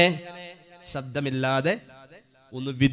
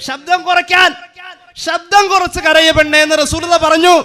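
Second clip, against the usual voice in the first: much higher sermon call; preaching at full force; 11 semitones higher, 7 dB louder than usual. Acted. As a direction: sing it up high over the crowd.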